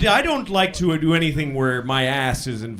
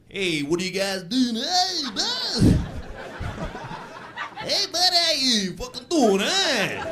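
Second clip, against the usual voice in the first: put-on high mixed Southern and Brooklyn accent; Alabama-Brooklyn accent; 7 semitones higher than usual. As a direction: greet like a half Southern, half Brooklyn guy. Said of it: "doesn't know if he's from Alabama or Brooklyn".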